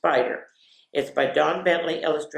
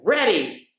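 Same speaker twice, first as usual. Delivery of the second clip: each word climbing higher and louder; excited child battle cry; 8 semitones higher, 5 dB louder, much slower than usual. Retold or imitated. Little girl calling to dad before leaping, rising excited countdown. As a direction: call it out like a little girl charging. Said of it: "and I would go".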